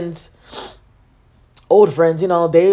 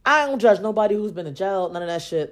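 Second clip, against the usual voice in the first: ordinary delivery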